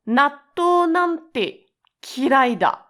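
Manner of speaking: high, slow and loud disgust at natto. Shown as a demonstration. Teacher demoing hatred of natto, disgusted. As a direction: spit it out like you hate it. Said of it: "to emphasize your disgust towards natto".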